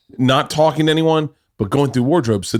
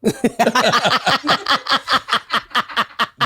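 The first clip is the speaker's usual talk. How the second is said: long high laugh; 10 semitones higher than usual. Real, not acted. Big laugh.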